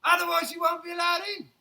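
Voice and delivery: high, loud warning; warning shout. Acted. Beefeater warning Paddington, loud shout. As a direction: bark the rule out firmly. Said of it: "warned the Beefeater".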